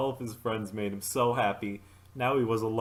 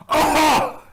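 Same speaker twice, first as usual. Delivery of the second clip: sudden high squeal; high startled yelp; 13 dB louder than usual. Acted. Mark yelps, lifted off his feet in a hug. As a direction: yelp as you're squeezed.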